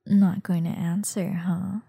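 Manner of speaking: usual speaking voice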